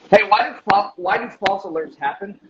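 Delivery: usual speaking voice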